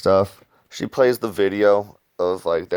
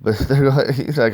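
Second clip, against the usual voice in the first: usual speaking voice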